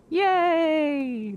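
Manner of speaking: high, bright cheer; playful high yay. Real, not acted. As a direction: celebrate with a squeal. Cheering about not peeking.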